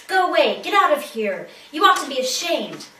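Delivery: sharp, loud scolding; angry hiss. Acted. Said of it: "she hissed at it".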